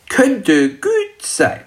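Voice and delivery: high, slow dragon voice; kleiner Weihnachtsdrache. Acted. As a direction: consider it slowly as a little dragon. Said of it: "antwortete der Weihnachtsdrache". (hohe, langsame Drachenstimme)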